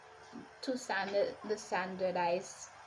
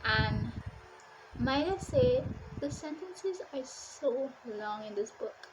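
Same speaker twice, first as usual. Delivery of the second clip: ordinary talk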